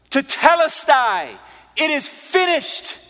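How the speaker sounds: high, slow, ringing proclamation; loud cry. Retold or imitated. Preacher re-enacting Jesus' cry from the cross, loud. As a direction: cry it out loudly, drawing out each word. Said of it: "he spoke it loudly".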